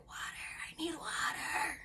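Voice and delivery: a hoarse, breathless whisper for water; dry-throated whisper. Retold or imitated. Patient waking up begging nurse for water, whispered. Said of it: "I was like".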